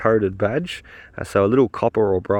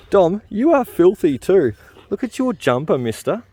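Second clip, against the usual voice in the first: playful scolding; 9 semitones higher, 3 dB louder than usual. Real, not acted. Dad teases his son about his filthy jumper, playful scolding. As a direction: tease him about the mess like a mock-stern dad.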